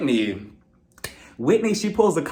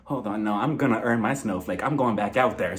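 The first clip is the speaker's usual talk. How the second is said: low, softer, resolute voice; low mock determination; 7 semitones lower, 4 dB quieter than usual. Heather quietly vowing to go back out, low and soft. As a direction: drop it low and quiet, set on going. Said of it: "she was like".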